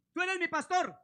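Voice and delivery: high and loud "Tú eres mi pastor". Retold or imitated. Preacher voices a believer, high loud declaration. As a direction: proclaim it up high.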